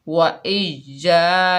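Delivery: slow, drawn-out chant of वा इज या, stretching the या; slow Quranic recitation. Shown as a demonstration. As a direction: chant वा इज या slowly, holding the long vowel. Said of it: "बगैर खींचे पढ़ेंगे".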